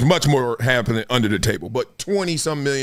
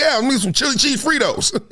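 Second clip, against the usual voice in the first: put-on kid ordering snacks; 9 semitones higher than usual. His nine-year-old self ordering.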